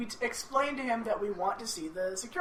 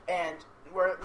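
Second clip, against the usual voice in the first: ordinary talk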